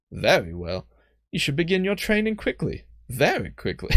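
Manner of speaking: put-on a half-hearted British accent on "Very well"; failing British accent. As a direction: try a British accent and lose it. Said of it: "I'm not channeling John Oliver today".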